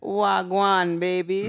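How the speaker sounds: loud put-on Jamaican accent. Shown as a demonstration. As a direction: show off a Jamaican accent, loudly. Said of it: "You wanna hear my Jamaican one?".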